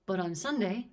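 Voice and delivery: usual speaking voice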